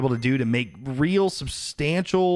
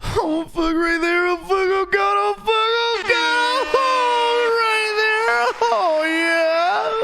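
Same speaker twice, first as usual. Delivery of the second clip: spicy pain; much slower than usual. Real, not acted. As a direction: wail through the burning mouth, half enjoying it. Eating very spicy food on stream.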